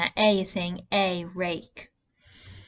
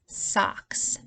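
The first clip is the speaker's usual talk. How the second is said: socks whispered to hear the vowel; whispered word; 3 dB quieter than usual. Shown as a demonstration. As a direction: breathe out socks. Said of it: "sentence for that word".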